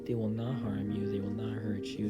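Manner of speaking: usual speaking voice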